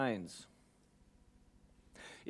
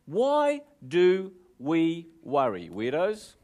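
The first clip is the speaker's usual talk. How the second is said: slow rhetorical question; much slower than usual. Acted.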